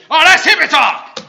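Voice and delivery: loud, high mock bellow at his son; gruff father shout. Father in a car ad scoffing at his son, loud mock shout. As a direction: bark it like a cranky old dad.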